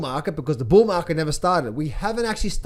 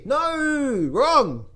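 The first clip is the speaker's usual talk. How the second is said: ordinary delivery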